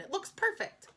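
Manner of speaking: put-on high girlish insistence; girl defending her neat lettering, voice high; whiny insistent girl. A narrator's reading. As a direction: insist it is perfect. Sheila defending her cover.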